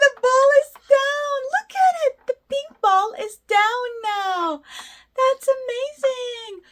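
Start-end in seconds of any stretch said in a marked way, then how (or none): 0.0-2.1 high, loud delighted exclaim; bright rising toddler-show cheer; excited cheer. Acted. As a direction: cheer like the ball just landed. Toy ball drops, host cheers.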